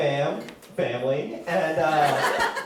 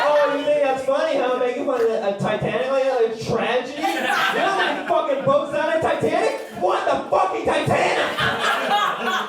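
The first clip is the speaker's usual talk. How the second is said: offended outburst; 6 semitones higher than usual. Acted. Offended at heckler's Titanic request.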